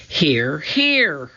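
answering call that jumps up in pitch. A narrator's reading. Annie from the treehouse to her brother, calling out. Annie calls back from above.

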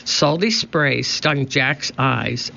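ordinary delivery

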